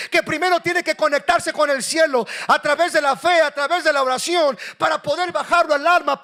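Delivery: loud, high, driving sermon shout; fiery preaching. Acted. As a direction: preach it out to the whole church at full force. Preaching at full force. (predicación a todo pulmón, alta y fuerte)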